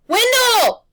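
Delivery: high, loud call; yelled name. A narrator's reading. Boy calling his friend as the beast comes, yelling. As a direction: yell for your friend in the fog. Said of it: "Harvey yelled".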